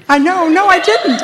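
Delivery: amused no, no; laughing denial. Real, not acted. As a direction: deny it through laughter.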